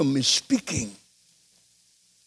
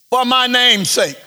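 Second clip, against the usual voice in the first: scripture reading; 4 semitones higher, 3 dB louder than usual. Acted. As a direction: read it as God's own words from the pulpit.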